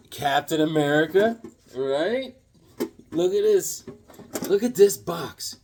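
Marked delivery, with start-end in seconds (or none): none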